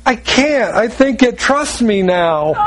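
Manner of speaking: high, plaintive mock wife; acted-out reluctant wife; imitated pleading wife. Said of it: "She goes".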